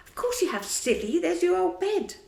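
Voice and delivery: big brother laughing it off; lightly voiced boy. John telling little Michael it's his old bed, boyishly.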